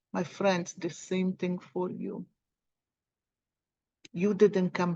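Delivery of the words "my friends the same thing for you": soft. Real, not acted. Repeated, softening to friends.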